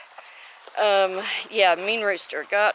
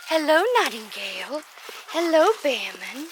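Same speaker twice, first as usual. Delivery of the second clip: higher sing-song pet talk; cooing to chickens; 4 semitones higher than usual. Real, not acted. Greeting her chickens, higher cooing voice. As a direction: coax the hens sweetly.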